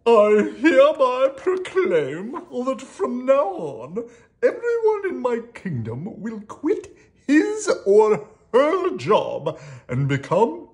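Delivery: loud, high, slow storybook king; royal proclamation. A narrator's reading. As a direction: proclaim it grandly to the whole kingdom. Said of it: "issued a royal proclamation".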